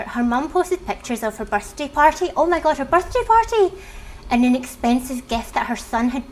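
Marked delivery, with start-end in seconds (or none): 2.4-3.7 high-pitched exclamation of surprise; surprised reaction. Real, not acted. Reader reacting to the stepdaughter's post, surprised. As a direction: react aloud to an unexpected twist.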